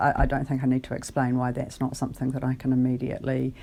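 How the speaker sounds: normal talking voice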